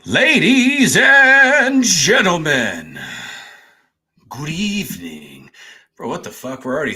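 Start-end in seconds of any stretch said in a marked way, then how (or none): 0.0-5.5 grand, slow show-host greeting, high and loud then dropping; announcer voice. Acted. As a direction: stretch it out like a boxing-ring announcer. Hammed-up show host opening.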